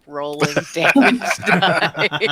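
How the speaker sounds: words breaking into real laughter; laughing. Real, not acted. Laughs while retelling a comic.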